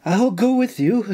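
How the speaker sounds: eager volunteering. A narrator's reading. Nora volunteering to go with the governor, eager. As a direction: pipe up to join in. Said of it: "Nora volunteered".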